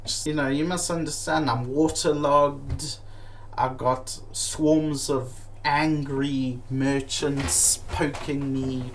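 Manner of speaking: ordinary delivery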